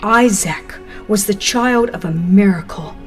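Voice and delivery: ordinary delivery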